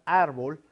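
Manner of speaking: árbol, weight up front on ár; stress on first syllable. Shown as a demonstration. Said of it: "en la sílaba ar".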